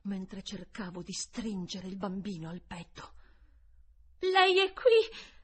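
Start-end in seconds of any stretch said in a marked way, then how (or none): 4.2-5.3 loud, high, slow anxious cry; frightened boy crying out; anxious question. A narrator's reading. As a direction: call out the question in alarm, drawn out. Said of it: "domandò Miles con ansia".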